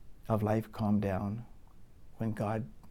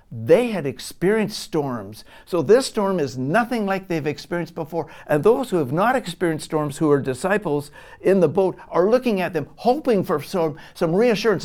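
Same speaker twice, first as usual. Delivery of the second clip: louder and higher, line by line; preaching building to a peak; 6 semitones higher, 9 dB louder than usual. Acted. Preacher telling the church of the frightened disciples, climbing in force.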